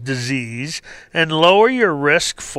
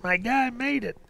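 fading echo; 6 semitones higher, 9 dB quieter than usual. Retold or imitated. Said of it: "everybody's screaming".

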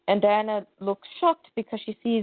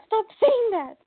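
even higher repeated mock yell; ballistic outburst; 12 semitones higher than usual. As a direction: push the repeat up into a near squeal. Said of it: "And they go ballistic".

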